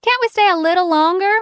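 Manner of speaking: high, loud pleading whine; whining. A narrator's reading. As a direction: beg to stay, whiny. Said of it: "Lily whined".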